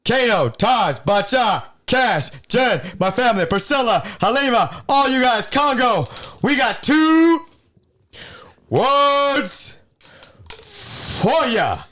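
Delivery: high, loud wrestler's taunt; wrestling promo shout. Pretend.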